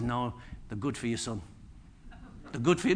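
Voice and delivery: deep fatherly reassurance from the Lord; voice of God. Pretend. Voicing God's reply.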